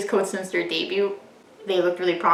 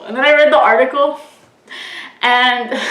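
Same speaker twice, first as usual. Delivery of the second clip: ordinary talk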